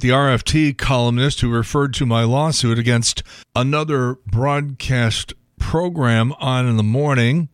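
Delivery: ordinary delivery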